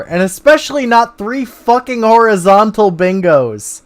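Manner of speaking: loud, high disbelief; excited. Real, not acted. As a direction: shout the win. Triple bingo excitement.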